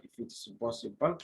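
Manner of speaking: usual speaking voice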